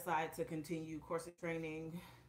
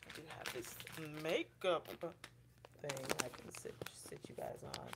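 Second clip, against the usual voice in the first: whisper; 3 semitones lower than usual. Real, not acted. Propping up the camera, muttering.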